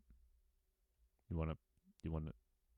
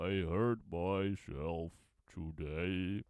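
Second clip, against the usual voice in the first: [name] impression; much slower than usual. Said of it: "I think I could do [name]".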